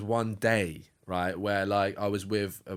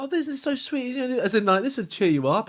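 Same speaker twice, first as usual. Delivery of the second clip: much higher sweet coo at a fox; cooing girlfriend; 11 semitones higher than usual. Retold or imitated. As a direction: gush over the cute fox, high up. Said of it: "It's like".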